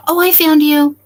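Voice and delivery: delighted discovery. Real, not acted. Streamer finds her guest in the basement, delighted. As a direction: burst out with relief at finding her.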